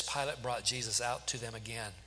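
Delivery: ordinary delivery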